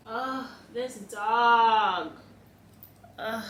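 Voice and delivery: long fed-up sigh at the dog; exasperated groan. Real, not acted.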